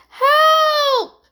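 very high, long cry; desperate yell. A narrator's reading. Girls from the sinking boat, yelling. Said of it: "yelled the girls from the boat".

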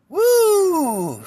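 long high "woo" sliding down; high whoop. Real, not acted. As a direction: let out a drawn-out, high woo. Exclaims after hearing own voice.

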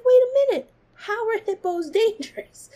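high, loud disbelief, laughing at the end; wait, hippos are dangerous?; incredulous. Retold or imitated. Vlogger recalling her surprise at dangerous hippos, high incredulous. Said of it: "So I was just like".

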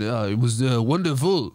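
low, halting mock French accent; muddled French accent. Answering girlfriend after the X-ray, low mock French accent. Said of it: "Do you have a French accent?".

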